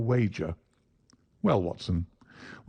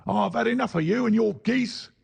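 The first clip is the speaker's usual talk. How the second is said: much higher, angry salesman's shout; 13 semitones higher than usual. Acted. Salesman shouting at the cringing man, angry. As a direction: bellow it at the pestering little man. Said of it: "he shouted".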